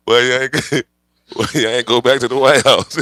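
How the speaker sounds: loud, laughing through the question. Real, not acted. Laughing at rioters, loud and amused. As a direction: keep cracking up as you ask. Laughing while mocking the crowd.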